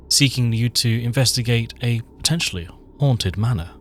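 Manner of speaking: loud dramatic scene-setting read; story narration. A narrator's reading.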